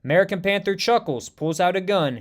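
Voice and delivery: plain reading voice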